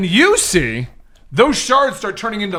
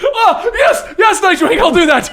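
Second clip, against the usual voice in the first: loud, high, hammed-up shopkeeper; high and loud eager shop owner agreeing; 12 semitones higher, 4 dB louder, slower than usual. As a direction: yelp it back like a startled clerk. GM voices shopkeeper answering Nightwing.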